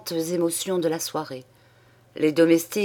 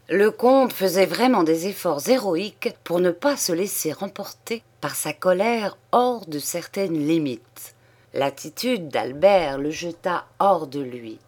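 plain reading voice

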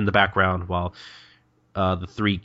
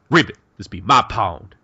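louder, put-on croaking frog; 5 dB louder than usual. Host guesses what the frog says, croaking frog voice. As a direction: play a territorial frog claiming its turf. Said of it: "he's probably just like".

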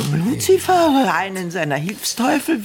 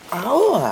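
ouch; 4 dB louder than usual. Acted. Carer handles her stiff body.

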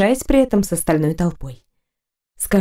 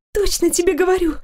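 put-on girl voice; 10 semitones higher than usual. A narrator's reading. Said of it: "бросила первая девушка".

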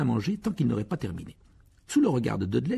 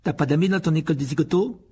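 higher, louder mocking boy; loud schoolyard sneer; taunting bully; 7 semitones higher, 6 dB louder than usual. A narrator's reading. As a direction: jeer at him like a spoilt kid. Dudley taunting Harry.

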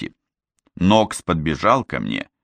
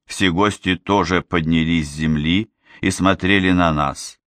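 ordinary delivery, nothing marked

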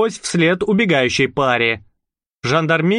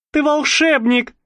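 delighted; 12 semitones higher than usual. A narrator's reading. Delighted girl.

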